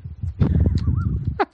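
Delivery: squeaky helpless laugh; high burst of laughter. Real, not acted. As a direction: crack up at your own joke. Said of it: "I'm laughing at my own joke".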